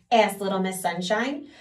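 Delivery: plain reading voice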